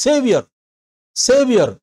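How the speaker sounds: సేవియర్ with a short యర్; correct Saviour. Shown as a demonstration. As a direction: model సేవియర్ cleanly. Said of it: "యా అనేది దీర్ఘం ఇవ్వకూడదు".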